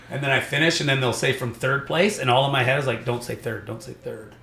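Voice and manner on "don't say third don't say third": soft muttered wish, repeated; will it under your breath; hushed pleading. Retold or imitated. Retold silent pleading in his head.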